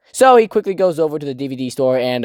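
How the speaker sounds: ordinary talk, nothing marked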